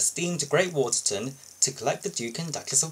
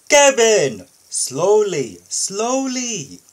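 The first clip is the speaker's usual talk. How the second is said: high, loud scolding call; exasperated shout; 7 semitones higher, 8 dB louder, much slower than usual. Acted. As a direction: snap at the clumsy crane to slow down. Boss scolds clumsy crane.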